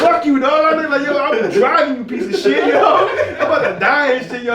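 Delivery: high, loud outrage breaking into laughs; heated rant. Retold or imitated. Buyer cursing out the seller for the strong brownie, heated and laughing. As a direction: rant at him, half laughing.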